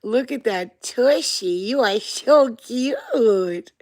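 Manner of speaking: high, loud, drawn-out cooing. Real, not acted. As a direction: gush over a cute pet, stretching the words out. Gushing over a pet.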